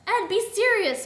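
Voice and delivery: high, loud exasperated scolding; exasperated reprimand; complaining. A narrator's reading. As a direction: complain sharply at a silly partner. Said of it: "Miss Scarlet complained".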